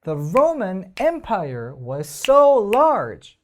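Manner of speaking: very slow, loud, so and Roman punched; The Roman Empire was so large with stresses brought out; stressed reading. Shown as a demonstration. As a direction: read it while bringing out the stresses. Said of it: "강세 살려서 읽어볼게요".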